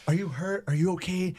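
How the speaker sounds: frantic concern for a crying client; worried. Retold or imitated. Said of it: "I'm freaking out".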